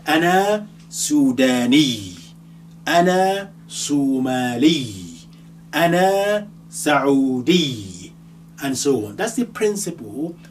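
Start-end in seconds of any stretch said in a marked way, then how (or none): 0.1-8.1 Ana Sudani said slowly and a bit louder; slow demo phrases. Shown as a demonstration. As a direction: model each phrase slowly for learners. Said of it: "I will say".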